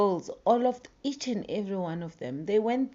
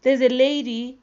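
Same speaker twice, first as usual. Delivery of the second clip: animated loud; 5 semitones higher, 8 dB louder than usual. Real, not acted. Animated storytelling.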